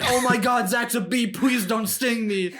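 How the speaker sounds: high, slow mock fright; mock panic. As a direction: squeal it like a scared stoner. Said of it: "And you were like".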